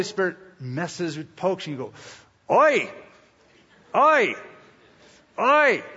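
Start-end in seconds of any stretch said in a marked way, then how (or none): 2.5-5.8 high, loud "oi" yelps; mock pained cries, sharp and separate; poked yelp. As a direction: yelp as if jabbed in a sore spot. Acts out being poked.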